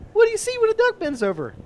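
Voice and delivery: high cheesy joke voice, way up; embarrassing dad joke. Shown as a demonstration. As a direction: ham up the corny setup. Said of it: "ask it a different way".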